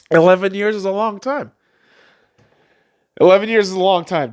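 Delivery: high, tearful and strained; pushing the words out through tears. Real, not acted. Reflecting on eleven years since graduation, tearful.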